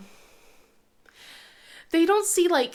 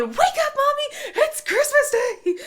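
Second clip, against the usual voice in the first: put-on much higher, louder kid voice through laughter; excited kids; 7 dB louder than usual. Kids waking mom on Christmas, high excited squeal. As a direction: squeal it like kids jumping on the bed. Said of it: "jumping on my bed and going".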